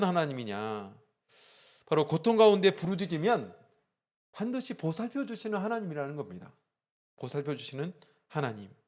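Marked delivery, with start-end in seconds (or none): 1.9-6.5 higher, louder preaching peak; preaching emphasis. Acted. Pastor urging the congregation, higher and louder. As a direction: drive home the promise to the congregation.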